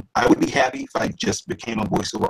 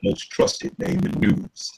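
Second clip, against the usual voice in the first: James Earl Jones; 11 semitones higher than usual. Acted. Voice actor demonstrating the CNN tagline for his host, James Earl Jones impression. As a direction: draw it out like a famous network announcer. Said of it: "he does C N N".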